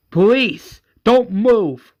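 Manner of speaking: loud, high cop command; police shout. Retold or imitated. Officers shout down the shooters, loud commanding yell. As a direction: bark it like officers breaking in.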